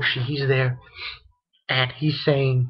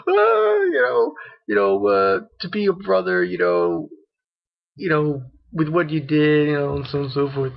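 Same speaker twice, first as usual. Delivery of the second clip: imitating Frankie bawling. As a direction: wail it out like a sobbing tough guy. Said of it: "when he says".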